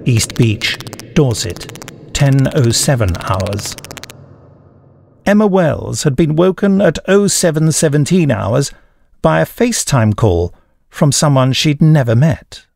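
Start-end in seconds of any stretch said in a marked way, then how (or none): none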